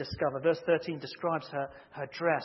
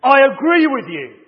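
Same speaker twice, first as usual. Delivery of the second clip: higher, much louder mock-furious yell; furious shout; 9 semitones higher, 20 dB louder than usual. Baseball player yells agreement at the umpire, furious shout. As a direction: bellow it like a raging ballplayer. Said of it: "shouted with a furious look on his face".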